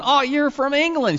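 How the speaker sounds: high, loud exclamation of recognition; retold eager guess. Guessing a lady's homeland in Paris, high excited exclamation. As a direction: blurt it out, pitched well up. Retelling his surprised guess to her.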